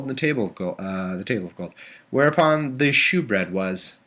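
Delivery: normal talking voice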